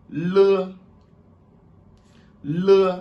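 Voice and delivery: le high and loud, as Mandarin le; le said like the Chinese l; le as the Chinese letter l; Chinese l sound. Shown as a demonstration.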